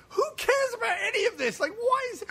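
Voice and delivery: high-pitched incredulous outcry; exasperated. Retold or imitated. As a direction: throw up a baffled, high-pitched protest. Exasperated reaction to the film.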